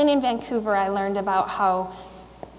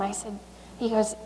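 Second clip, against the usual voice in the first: usual speaking voice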